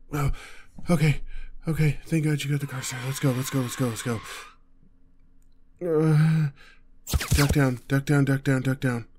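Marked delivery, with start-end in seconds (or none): 0.9-4.2 loud, higher, hurried repeats; frantic relief. Acted. Jumping into the started car, frantic relief and urging. 7.4-9.1 loud, rapid, repeated command; urgent warning shout. Acted. Warning partner to duck in the getaway car, urgent shout. Under fire while driving.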